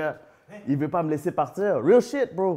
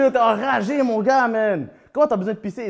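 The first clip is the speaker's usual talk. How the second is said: higher, louder disbelief at his cousin; incredulous yell; 9 semitones higher, 7 dB louder than usual. Retold or imitated. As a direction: shout it, outraged and amused. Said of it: "je suis comme". (crie, incrédule, au cousin qui veut pisser)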